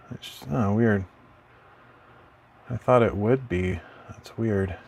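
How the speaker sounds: normal talking voice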